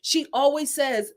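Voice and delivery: usual speaking voice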